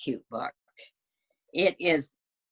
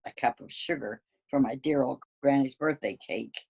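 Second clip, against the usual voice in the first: ordinary delivery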